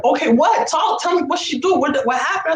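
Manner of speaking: high, pressing retold questions; urgent demanding. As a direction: imitate an alarmed sister demanding answers. Sister retold demanding answers.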